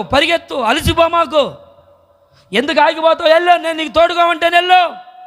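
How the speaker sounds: high, rising, building urgency; God urgently driving David forward; urgent command. Acted. God urging David to run.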